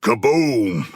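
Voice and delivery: normal talking voice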